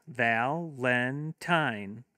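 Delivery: Valentine very slow, three drawn-out beats; slow syllables. Shown as a demonstration. Teacher modelling clapping for kids, Valentine in slow beats. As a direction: stretch Valentine into three claps. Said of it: "have your kids clap".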